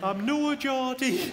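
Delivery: high, slow, badly faked Sunderland accent; terrible Sunderland accent. Barmaid from Sunderland insists to the customer, terrible Sunderland accent. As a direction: draw out a hammed-up Sunderland accent, pitched high. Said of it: "that's a terrible accent".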